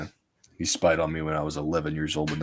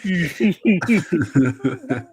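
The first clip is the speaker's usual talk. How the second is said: high laugh; 10 semitones higher, 5 dB louder than usual. Real, not acted. Laughing at joke.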